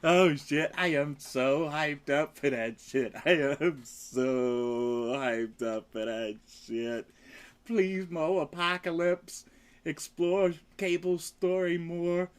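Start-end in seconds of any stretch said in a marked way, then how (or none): 3.2-7.1 low, very slow, stretching SO; slow emphatic repeat. Real, not acted. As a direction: drag out "so hyped up" for emphasis.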